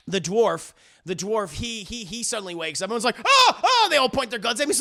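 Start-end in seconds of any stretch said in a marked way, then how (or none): 3.2-3.9 two high, loud "oh" yelps; hammed-up jolt of alarm; mock startled cries. Everyone sees dwarf suddenly wake, acted startled yelps.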